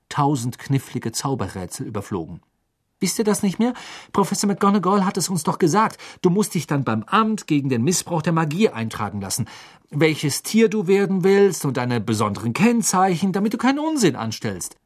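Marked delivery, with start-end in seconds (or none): 3.0-14.8 high, insistent, exasperated lecture; belehrend. A narrator's reading. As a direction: lecture them emphatically, pitch raised. Hermine belehrt die Jungen gereizt. (eindringlich und hoch belehrend)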